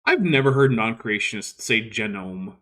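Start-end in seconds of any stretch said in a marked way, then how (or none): 1.9-2.5 GENOME said "JEN-ome"; mimicked mispronunciation. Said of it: "because he says gene".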